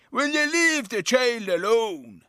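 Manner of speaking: imitating Papa. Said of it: "he says".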